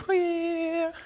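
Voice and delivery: high, drawn-out "whee"; thin, high comic kung-fu noise; mock karate cry. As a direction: squeal like a karate movie kick. Mock Karate Kid martial arts cry.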